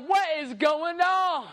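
slow, high, stretched-out amazement; acted astonishment. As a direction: draw it out high, as if dumbfounded. Disciples see Jesus shining.

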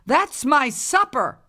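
high indignant shout; yelling. Acted. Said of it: "yelled the old woman".

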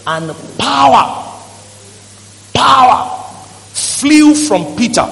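slow, loud, drawn-out power; dramatic emphasis. Real, not acted. Preacher tells church how power flew, slow and loud. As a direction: stretch out power for effect.

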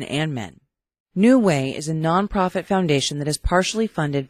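upbeat radio ad voice; ad read. Acted. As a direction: sell it like a radio spot. Recorded ad read by host.

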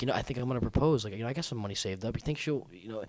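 quick, low, soft confiding; fake earnest musing. Pretending to plan a proposal, fake-earnest. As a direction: confide it shyly, trailing off softly. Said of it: "I'm spitting ridiculous bullshit".

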